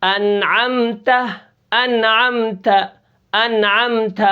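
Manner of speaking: slow انعمت with a breath released on the final ت; teacher stressing the final ت of انعمت; recitation demo. Shown as a demonstration. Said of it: "یہ دیکھیں آخر میں".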